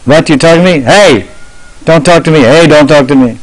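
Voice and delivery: high-pitched indignant boy, playfully acted; mock angry. Cowherd boy arguing with an echo from a well, mock anger. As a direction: challenge the echo in pretend outrage. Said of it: "would get angry with the echo".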